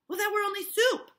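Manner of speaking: put-on high, loud frightened girl; Abigail's voice. Abigail answering the minister, high and loud. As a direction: squeak it out like a caught girl. Teacher voicing Abigail in scene.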